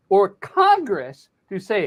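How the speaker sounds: usual speaking voice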